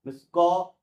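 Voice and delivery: ordinary talk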